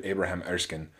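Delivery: normal talking voice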